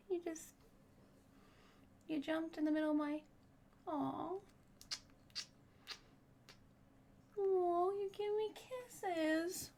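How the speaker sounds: high, soft cooing to a pet. Real, not acted. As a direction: coo softly like to a kitten. Baby talk to her pet.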